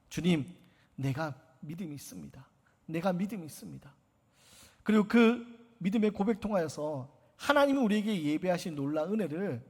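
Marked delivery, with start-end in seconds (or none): 0.1-3.9 quiet confession. Acted. Quiet confession of faith voiced.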